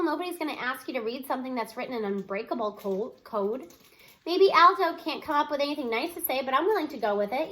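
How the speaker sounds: plain reading voice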